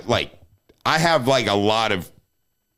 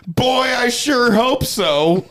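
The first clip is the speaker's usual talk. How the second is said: high, slow, loud yell of the Vine line; Vine quote; 10 semitones higher, 4 dB louder, much slower than usual. Retold or imitated. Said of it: "And I'll be like".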